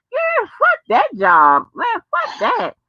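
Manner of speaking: high, slow, drawn-out dismissal; fed-up refusal. Real, not acted. Telling the caller she'd quit that job, drawn-out disgust. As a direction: stretch it out like you're done with it for good.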